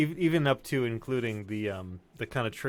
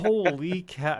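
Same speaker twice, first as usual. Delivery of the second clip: amazed; 6 semitones higher, 4 dB louder, slower than usual. Real, not acted. Reacting to the movie.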